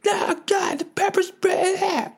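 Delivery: very high, mock-terrified wail; panicked crowd. Protesters fleeing riot cops and pepper spray, mock panic. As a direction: imitate people crying out in panic. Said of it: "And everyone's like".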